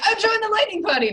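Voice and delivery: bubbly cleric. Acted. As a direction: chirp it out cheerfully. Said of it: "She's still like".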